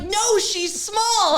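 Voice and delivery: high, drawn-out objection; stretched-out, high-pitched mock outrage; mock protest. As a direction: protest it in exaggerated slow motion. Acts out protest to commenters.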